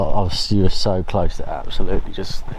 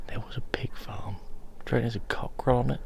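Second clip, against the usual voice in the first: whispering; 4 semitones higher, 3 dB quieter than usual. Real, not acted. Whispering while walking at night.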